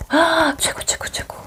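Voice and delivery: breathy whispered praise; clap-along whisper of delight; whispered cheer. Retold or imitated. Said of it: "막 이렇게 박수를 치니까". (속삭이듯 감탄하며)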